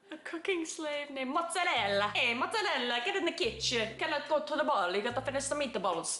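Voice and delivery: cartoonish fake Italian accent; worst Italian accent. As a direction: do a bad comic Italian accent. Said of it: "the worst Italian accent ever".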